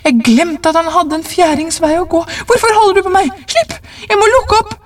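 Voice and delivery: high-pitched, frantic pleading; desperate shouting. A narrator's reading. Wife fights husband to open the door, frantic high cry. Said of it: "ropte hun og kjempet for å komme løs".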